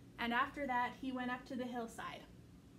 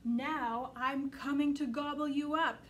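dim booming ogre; 6 dB louder than usual. Acted. Troll threat.

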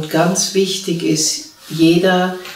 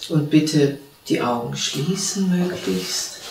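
calm, unhurried meditation voice with long pauses; guided meditation. Real, not acted. (ruhige Stimme einer geführten Meditation, mit langen Pausen)

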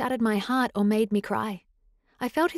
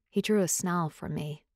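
plain reading voice

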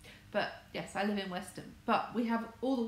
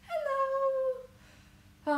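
excited greeting; 7 dB louder than usual. Real, not acted. Greeting another arriving viewer.

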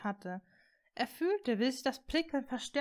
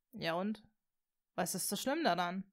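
verwirrt; 3 semitones lower, 3 dB quieter than usual. A narrator's reading. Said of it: "fragte Draco verwirrt".

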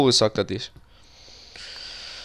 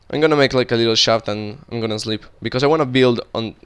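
normal talking voice

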